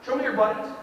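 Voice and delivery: usual speaking voice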